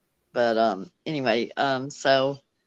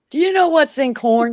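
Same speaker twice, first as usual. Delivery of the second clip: mock rant, higher and a bit louder; retold yelling; 9 semitones higher, 4 dB louder than usual. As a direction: imitate the doctor ranting at his staff. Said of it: "like, yelling".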